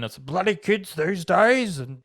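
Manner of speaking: lightly voiced grumpy old man; a hint of a grumbling old man. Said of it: "I sound so, like an old man".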